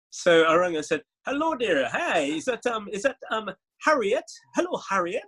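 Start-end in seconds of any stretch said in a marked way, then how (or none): 1.3-5.2 put-on high, sing-song Caribbean accent; Caribbean Man. Cold-calling a journalist in a put-on persona, Caribbean accent. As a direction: put on a lilting Caribbean greeting. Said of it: "when I was doing Caribbean Man".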